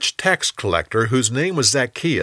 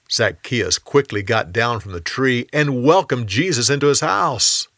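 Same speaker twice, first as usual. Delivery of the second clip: ordinary delivery, nothing marked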